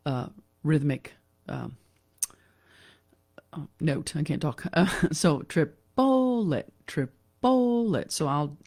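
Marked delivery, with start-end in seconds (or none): 5.4-8.1 triplet with a high, drawn-out middle syllable; slow triplet, the middle beat leaping out; accent on middle syllable. Shown as a demonstration. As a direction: swoop up and linger on the middle of triplet. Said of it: "I'll do the accent on the middle".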